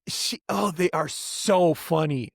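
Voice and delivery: normal talking voice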